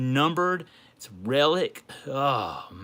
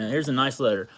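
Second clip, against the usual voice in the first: ordinary delivery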